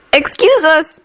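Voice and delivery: put-on high polite child; timid small boy; little boy voice. A narrator's reading.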